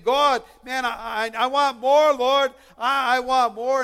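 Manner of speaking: crying out to God. Acted. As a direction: call out insistently to God, mildly yelling. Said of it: "God wants us to be yelling out to him".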